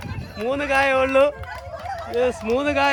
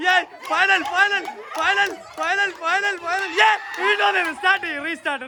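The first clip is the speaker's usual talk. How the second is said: high, excited play-by-play; excited race call; 6 semitones higher, slower than usual. Acted. Commentating the siblings' ball-blowing race, high and excited. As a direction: cheer the kids on like a commentator.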